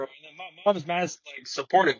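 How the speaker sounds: normal talking voice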